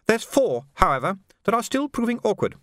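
high, composed society lady; a poised hostess, lightly voiced; genteel lady voice. A narrator's reading. Madam's voice in story.

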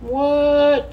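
high, drawn-out what; mock surprise. Cohost hearing a Chiefs player was arrested, mock shock. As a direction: fake shock at the news.

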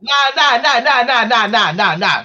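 high, loud string of objections; loud protest. Real, not acted. Cutting off the co-host in argument.

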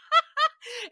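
sudden loud high burst of laughter. Real, not acted. Laughing loud at his own cruise-ship joke.

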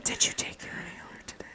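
wheezy ASMR whisper. Pretend. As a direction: breathe it out in a wheeze. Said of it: "It's just full-on wheezing".

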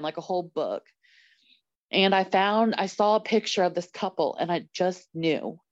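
ordinary talk

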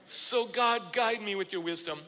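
loud, high, fast string of pleas to God; fervent preaching. Acted. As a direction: preach an urgent prayer, tumbling forward. Urgent prayer in preaching.

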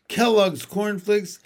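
ordinary delivery, nothing marked

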